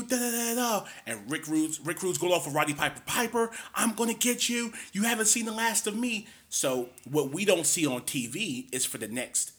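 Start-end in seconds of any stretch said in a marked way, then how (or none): 0.0-0.9 high mocking taunt, singsong; mustache twirling villain. Piper taunting Rude after costing title. 3.1-6.3 villain threat. Retold or imitated. Rude threatening Piper back.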